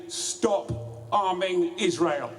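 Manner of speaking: ordinary talk, nothing marked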